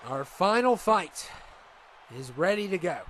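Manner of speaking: normal talking voice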